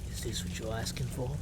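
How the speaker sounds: low, hushed, badly faked British accent; mock British ASMR, quiet and close; attempted British ASMR. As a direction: murmur it softly in a shaky attempt at British. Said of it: "I can't do a British accent".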